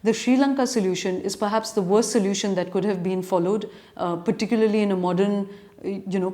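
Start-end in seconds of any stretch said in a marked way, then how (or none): none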